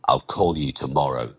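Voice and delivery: low and quick threat; menacing warning. Acted. Lender grabbing the borrower's arm as he leaves, menacing. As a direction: drop the voice and warn him curtly.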